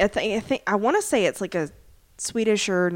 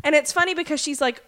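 ordinary talk

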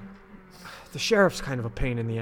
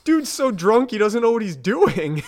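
ordinary delivery

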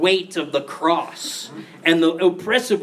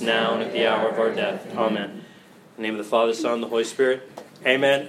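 prayer; 7 semitones lower than usual. Acted. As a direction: lead the room through a Hail Mary. Leads Hail Mary for bishop.

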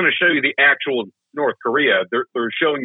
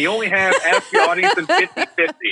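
quick game show host voice; mock game show host, brisk and bright; Regis impression; 7 semitones higher than usual. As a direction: play the quiz host refusing the lifeline. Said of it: "That was my Regis".

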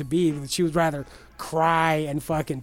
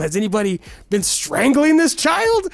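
higher, louder imitation of a worried doctor; alarmed doctor; 7 semitones higher, 6 dB louder than usual. Doctor questioning the mom about the child, mock alarm.

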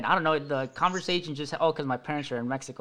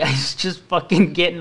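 ordinary talk, nothing marked

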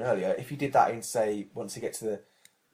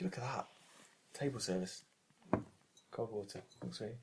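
quiet aside; 12 dB quieter than usual. Real, not acted. Aside to staff serving water.

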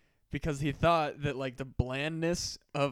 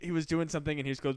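ordinary delivery

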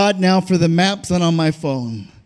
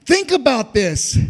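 fervent, raised preaching cadence; preaching at full force; 5 semitones higher than usual. Acted. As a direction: preach it out with a hoarse-free swell. Preacher building fervor.

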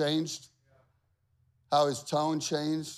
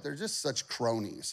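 ordinary talk

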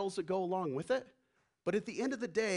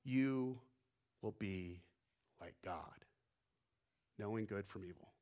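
hushed serpent; 10 semitones lower, 9 dB quieter than usual. Retold or imitated. The serpent tempts Eve, hushed and low. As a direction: lure them in softly like the serpent. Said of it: "He says".

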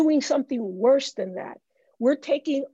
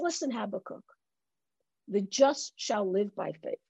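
low, soft and steady reply; God calmly answering the prophet; 5 semitones lower, 6 dB quieter, faster than usual. Retold or imitated. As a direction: answer him gently, as God. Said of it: "And God says".

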